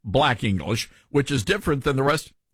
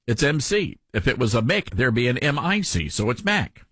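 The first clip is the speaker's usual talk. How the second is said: ordinary talk, nothing marked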